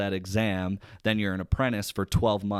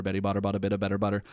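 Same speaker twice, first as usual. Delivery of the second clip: fast tongue twister; much faster than usual. Shown as a demonstration. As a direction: rattle the line off fast. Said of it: "I could kinda do it for you".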